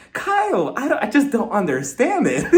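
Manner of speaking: high, baffled plea to Kyle; high exasperated. Retold or imitated. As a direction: pitch up in disbelief. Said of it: "And I'm like".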